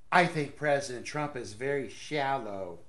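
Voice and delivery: low, lightly mocking quote; mock straight face. Host quoting a snubbed politician, mock serious. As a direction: quote her while holding back a laugh. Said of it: "can't even say it with a straight face".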